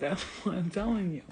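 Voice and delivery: normal talking voice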